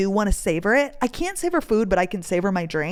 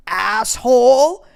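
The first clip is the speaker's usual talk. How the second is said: loud, drawn-out insult rising at the end; hammed-up indignant name-calling; mock indignation; 3 semitones higher, 8 dB louder, much slower than usual. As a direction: stretch it out slowly and louder. Mock insult at eating boyfriend.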